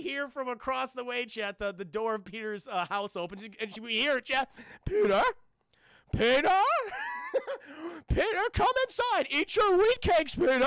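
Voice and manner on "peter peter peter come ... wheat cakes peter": put-on loud, high-pitched old aunt calling out; Aunt May. Aunt calling her nephew inside, loud and high. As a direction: holler shrilly for your nephew. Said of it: "It's like, it's Aunt May".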